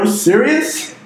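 high incredulous rise. Real, not acted. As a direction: ask it like you can't believe it. Incredulous rhetorical question.

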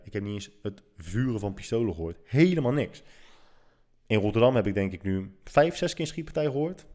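ordinary talk, nothing marked

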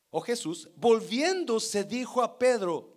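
normal talking voice

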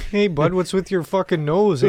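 teasing Canadian reindeer; put-on Canadian accent. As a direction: heckle in a thick Canadian accent. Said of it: "very Canadian accent?".

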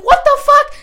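high, loud retold outburst; shocked, high-pitched exclamation; acted shock. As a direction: re-enact yelling in disbelief. Acting out own shocked reaction.

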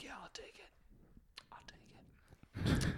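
soft whispered yes, slowing down; hushed whisper. Retold or imitated. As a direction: whisper it like a secret bargain. Sneaky deal at the beer stand.

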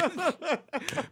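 high-pitched laughing fit; high burst of laughter. Real, not acted. Laughing at the cabinet joke.

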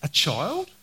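incredulous question with a sharp rise; doubting listener. Acted. Preacher voices Israelites doubting Isaiah's hope, incredulous. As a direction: query it in disbelief. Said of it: "the Israelites might sit, sit there and say".

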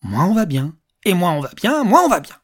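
put-on showy old man working up a chant; hamming it up. A narrator's reading. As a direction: play to the gallery, each repeat bigger. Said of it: "encore un peu élevé la voix, il cabotinait presque".

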